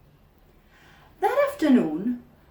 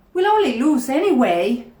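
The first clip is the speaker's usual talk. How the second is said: put-on loud whiny kid; 5 semitones higher, 10 dB louder than usual. Lazy team kids complaining.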